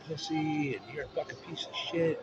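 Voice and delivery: high, soft imitation of name-calling; understated mimicry of a partner's abuse; mocking retold insults.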